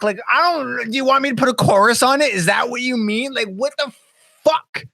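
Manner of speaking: high-pitched, flustered questioning; bewildered rant at a client; exasperated. Acted. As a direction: rattle out baffled questions, then curse. Baffled reply to vague client note.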